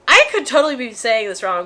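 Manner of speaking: ordinary talk, nothing marked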